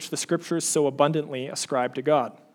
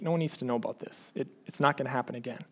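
fast, softer self-excusing thought; inner thoughts; 2 semitones lower, 7 dB quieter, faster than usual. Pretend. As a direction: rush it under your breath as a quick excuse. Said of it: "You think".

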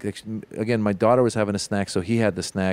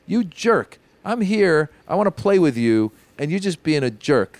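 higher, frustrated mock scold; a fed-up dad venting at his kid, half-joking; exasperated scolding; 6 semitones higher than usual. Dad retells scolding his son.

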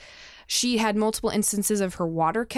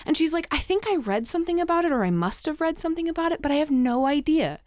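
mom's voice; 8 semitones higher than usual. Retold or imitated. Mom's quoted reply, voiced.

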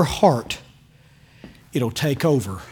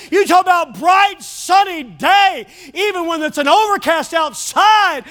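preaching; 11 semitones higher, slower than usual. Real, not acted. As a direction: preach it with rising joy. Preaching at full force.

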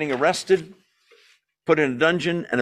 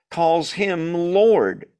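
"calls him Lord" slowed right down, Lord louder; drawn-out emphasis; 4 semitones higher, 4 dB louder, much slower than usual. Acted. Preacher landing the quoted riddle, slow and weighted. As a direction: stretch out the final words.

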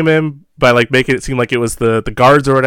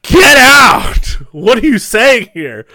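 high, loud mock shout of disbelief; shocked disbelief; 11 semitones higher, 4 dB louder, much slower than usual. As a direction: yell it in amazed disbelief. Banquo reacting to witches.

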